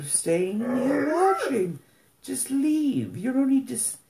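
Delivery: high, exasperated plea to just leave. Real, not acted. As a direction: throw it up high in disbelief. High exasperated retort to haters.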